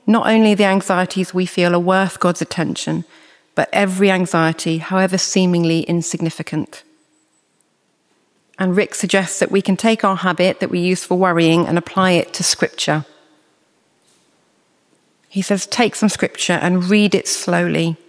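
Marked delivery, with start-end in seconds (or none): none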